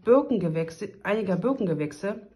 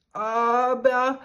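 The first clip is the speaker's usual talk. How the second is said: stockend; 5 semitones higher, 4 dB louder than usual. Acted. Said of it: "fragte die Prinzessin stockend".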